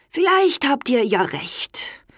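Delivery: put-on tired old king. A narrator's reading. Old king yields to his sons, lightly voiced and weary. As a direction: give in gently like an old, weak king.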